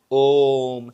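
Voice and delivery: ओम held out long, the vowel stretched very slowly; prolonged vowel. Shown as a demonstration. Teacher shows students a pluta vowel, ओम drawn out slowly. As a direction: stretch ओम far beyond a normal vowel. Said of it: "इसमें ज़्यादा समय लग रहा है".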